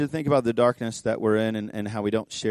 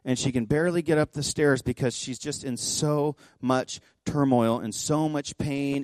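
ordinary delivery

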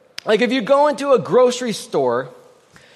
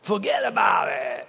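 Brooklyn. Retold or imitated. Pastor brushing off an offense in full Brooklyn shout. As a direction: bellow "Forget about it" like a Brooklyn guy. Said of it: "It's a Brooklyn version".

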